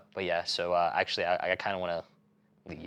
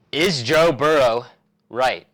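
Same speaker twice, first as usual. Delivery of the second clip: dramatic announcer; 10 dB louder, much slower than usual. Acted. Dramatic hot-take question.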